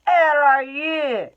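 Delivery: high, loud, slow screech; a magic purse shrieking for its owner; loud, slow, high shriek of a talking purse. Acted. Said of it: "it screeched".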